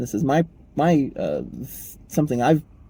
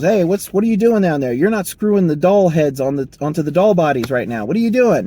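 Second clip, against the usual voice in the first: higher, louder scolding boss voice; annoyed boss; 5 semitones higher, 7 dB louder than usual. Retold or imitated. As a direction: bark at the idle worker like a foreman. Factory manager scolds daydreaming worker.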